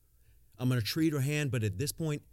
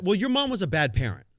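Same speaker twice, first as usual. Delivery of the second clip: louder, higher comeback to a critic; a sharp, raised put-down; blunt retort; 6 semitones higher, 5 dB louder than usual. Real, not acted. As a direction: shoot it right back, no patience. Snapping back at imagined listener.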